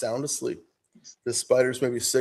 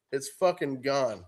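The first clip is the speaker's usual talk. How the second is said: ordinary talk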